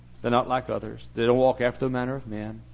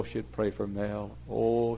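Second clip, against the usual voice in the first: ordinary delivery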